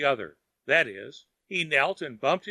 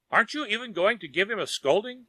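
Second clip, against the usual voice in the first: mild disgust, pitched higher; a touch of scorn at Lin's softness; 6 semitones higher than usual. A narrator's reading. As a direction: Wang scoffs at Lin's mercy, mildly disgusted. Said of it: "asked Wang in disgust".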